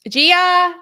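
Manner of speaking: high, loud call for the dog; calling out to Gia loudly. Real, not acted. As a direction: call her name across the house. Calling the dog across room.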